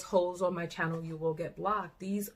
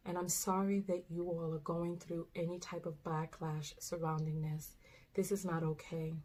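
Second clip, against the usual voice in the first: softer, tender reassuring voice; gentle reassurance; 3 semitones lower, 8 dB quieter than usual. Real, not acted. Host speaking to the young girls in the video, gently reassuring.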